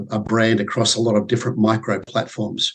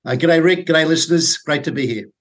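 Australian accent; 6 semitones higher than usual. Real, not acted. Guest greets host and listeners in a broad Australian accent. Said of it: "Thank you for using your real accent".

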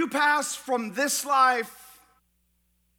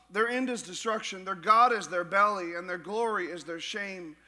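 lower, softer reading voice; scripture reading; 4 semitones lower, 4 dB quieter than usual. Acted. Pastor reading Philippians to the congregation, low, subdued scripture reading. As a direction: read the verse soberly, under the preaching voice. Reads a Bible passage aloud.

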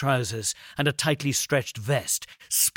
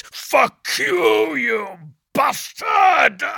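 furious shout; 12 semitones higher, 6 dB louder, much slower than usual. Acted. Bleacher fighting behind the door.

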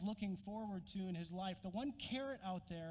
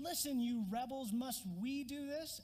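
louder, higher angry rebuke of the crowd; angry Moses; 6 semitones higher, 4 dB louder than usual. Acted. Moses scolding the thirsty people, angry rebuke. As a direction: Moses snaps at the rebels, fed up. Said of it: "angry Moses".

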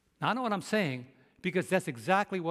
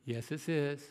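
lower, softer, patient phone reply; measured, resigned courtesy; restrained polite answer; 3 semitones lower, 4 dB quieter, slower than usual. Retold or imitated. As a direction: swallow the comeback and answer politely. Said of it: "servant of the Lord must not strive".

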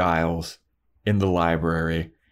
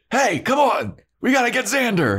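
calling out. Retold or imitated. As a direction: hurry a buddy along from offscreen. O'Toole calling offscreen.

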